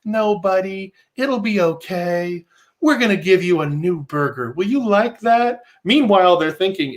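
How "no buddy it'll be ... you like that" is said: cloying sing-song reassurance, lifting high at the ends; mock babying voice; toddler talk. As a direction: soothe a grown man like a toddler. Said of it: "toddler language".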